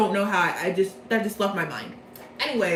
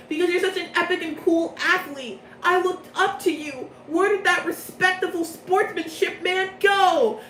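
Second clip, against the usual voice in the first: much higher, earnest pleading; pleading fan; 6 semitones higher than usual. Retold or imitated. Jack asking his idol Leona where he went, high plea. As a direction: beg like a heartbroken admirer. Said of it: "And then Jack is like".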